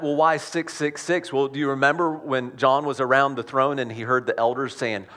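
usual speaking voice

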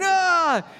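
high, loud falling scream; imitated kid's scream; Russell's scream. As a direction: scream as if the rope just snapped. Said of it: "Russell screaming".

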